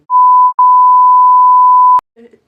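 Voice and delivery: big burst of laughter; loud laugh. Real, not acted.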